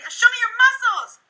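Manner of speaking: energetic prompt. Shown as a demonstration. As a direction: pump the kid up. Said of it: "I'll say".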